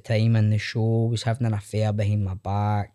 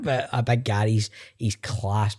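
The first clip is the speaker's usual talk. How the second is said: ordinary talk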